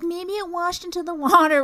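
high, loud mocking impression of a friend; loud, high-pitched mock naivety; tipsy friend. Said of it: "She's loosey-goosey, kinda drunk".

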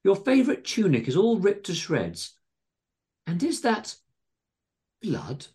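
high-pitched fussing alarm; dismayed landlady. A narrator's reading. Missus Tingleberry spotting damage on John's tunic, dismayed. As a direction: fret over him, halting before the last word. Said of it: "cried Missus Tingleberry".

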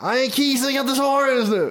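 put-on high-pitched Södermalm slang accent; Söder Stockholm voicemail. Voicemail for someone in Söder, Stockholm accent. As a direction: play an answering message in mock Söder dialect. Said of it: "om man ringer till någon i söder".

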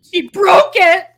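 high, loud burst of mock outrage; mock outcry. Co-host interrupting the purity talk reading, mock outcry. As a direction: yell it like a comic accusation.

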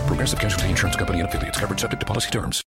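rapid-fire fine print; fast legal disclaimer. Acted. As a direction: rattle off the legal terms quickly.

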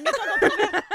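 laughing. Real, not acted. Laughing while teasing about kombucha.

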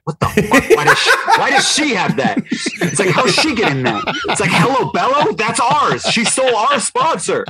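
mock a rival mom's envy; jealous mommy blogger. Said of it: "jealousy of being pissed".

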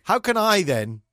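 ordinary delivery

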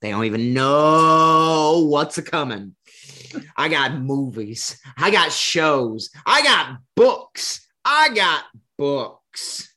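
big drawn-out showman hype, rising in pitch and volume; building, gleeful mock bragging; hyped-up boasting. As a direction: play the producer crowing about a master plan, stretching the key words. Acting out a boasting producer.

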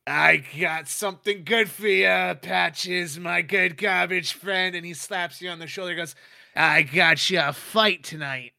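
Brixton boss voice. Acted. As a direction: sell the fix like a grinning boss.

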